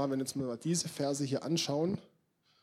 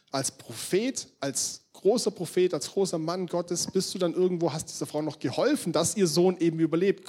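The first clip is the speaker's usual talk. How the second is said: usual speaking voice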